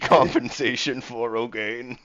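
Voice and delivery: loud, high pompous ruling; hammed-up refusal, breaking into a laugh; scornful captain. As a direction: declare it as a blustering captain. Game master plays scornful captain.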